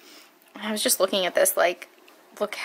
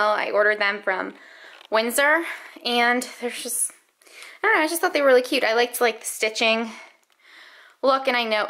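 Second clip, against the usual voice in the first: ordinary talk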